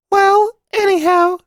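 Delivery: put-on high, firm boyish voice, slow; a small boy declaring himself boldly; strong voice. A narrator's reading. Boy to his mother, insisting firmly, high child voice. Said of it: "he said in a strong voice".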